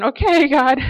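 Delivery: frantic plea. Retold or imitated. Panicked plea while speeding.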